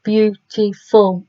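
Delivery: Beautiful slowed, feeling each beat; slow syllable demo. Shown as a demonstration. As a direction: say Beautiful slowly, hand on chin. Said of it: "So I want to try beautiful".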